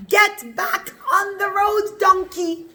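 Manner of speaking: high, slow storybook scolding; cross command. Acted. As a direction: order a stubborn donkey along, play-acted. Bilam orders the donkey back.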